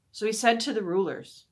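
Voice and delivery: ordinary delivery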